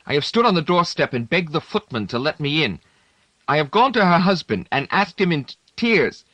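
pleading that breaks into tears; high, strained confession close to crying; on the edge of tears. Acted.

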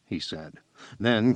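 ordinary delivery

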